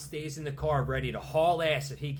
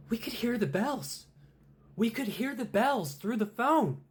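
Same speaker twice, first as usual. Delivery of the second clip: mildly voiced parents; 11 semitones higher, 5 dB louder than usual. Parents' eerie final line.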